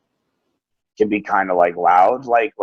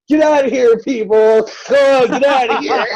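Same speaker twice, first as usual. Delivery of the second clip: put-on high, loud little-kid yell; imitated kid hollering at visitors; child yelling; 10 semitones higher, 4 dB louder than usual. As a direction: shoo people away like an indignant small boy.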